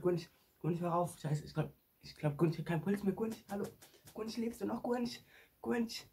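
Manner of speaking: rising, frantic cries of his name; shouting for a lifeless friend; panicked calling. Pretend. No pulse, panicked calls to Gunsch.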